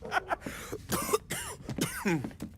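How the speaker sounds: trailing high laughter after a scare; prankster's laugh. Acted. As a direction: laugh at your own prank. Laughing after the fake explosion.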